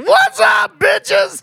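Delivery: high, loud yelling greeting; stepping out of the car, shouting hello; rowdy shouted greeting. Retold or imitated. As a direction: bellow it like a party arrival. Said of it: "walked out of the car and go".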